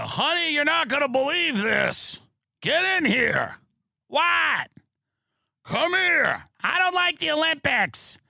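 put-on high, hollered Southern accent; bickering couple yelling in Southern accents. Ohio couple hollering between rooms about TV news, high Southern accent. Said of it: "I always do the Southern accent".

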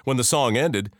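usual speaking voice

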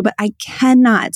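usual speaking voice

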